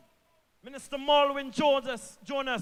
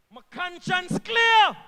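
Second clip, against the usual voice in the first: slow loud shout; 4 semitones higher, 9 dB louder, slower than usual. Real, not acted. Minister to the crowd, slow proud shout. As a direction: shout it slowly and higher. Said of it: "repeats line for emphasis".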